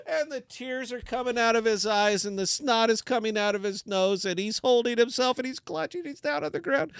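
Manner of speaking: high strained crying voice; squeaky, pained comic telling; mock tearful narration. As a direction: narrate through hammed-up agony. Narrates in kicked man's agonized voice.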